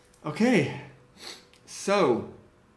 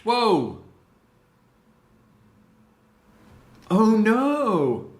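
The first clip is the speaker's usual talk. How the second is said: alarmed; 7 semitones higher, 7 dB louder, much slower than usual. Real, not acted. Program crashing.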